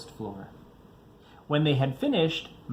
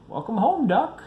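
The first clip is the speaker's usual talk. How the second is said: calling out; 9 semitones higher, 6 dB louder than usual. A narrator's reading. Bear greeting returning duck, loud high call. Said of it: "called Bear".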